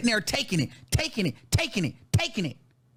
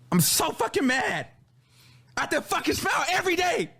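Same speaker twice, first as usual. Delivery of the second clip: higher-pitched, exasperated outburst; really mad; 5 semitones higher than usual. Real, not acted. Complaining about having to smile every day, mad. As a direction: protest at how you were treated.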